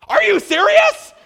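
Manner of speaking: very high, loud, drawn-out disbelief; hammed-up incredulous shout; beside herself. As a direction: shriek the question like an overwhelmed mom. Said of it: "She was just beside herself".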